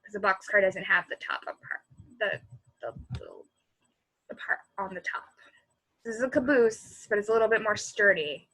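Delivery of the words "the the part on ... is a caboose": ordinary delivery, nothing marked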